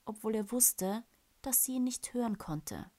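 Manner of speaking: ordinary delivery, nothing marked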